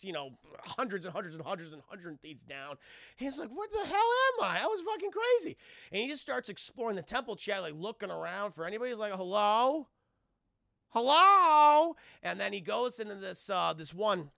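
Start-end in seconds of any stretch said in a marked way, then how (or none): 3.5-5.6 acted bewildered outburst. Johnny bewildered after being teleported. 9.1-9.9 louder, questioning call into an empty place; acted calling out. Johnny looking around for anybody, tentative calling out. 10.9-12.0 long, high, shouted hello; acted loud calling out. Johnny looking around for anybody, loud drawn-out holler. Johnny calls again, much louder.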